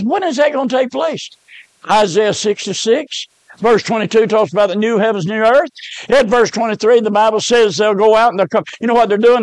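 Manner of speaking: usual speaking voice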